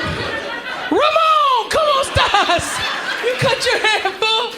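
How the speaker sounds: high, loud slow call-out; mock Mexican greeting. Comic hollering at a Mexican friend, mock greeting, high and loud. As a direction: hail a buddy across the room, high and loud.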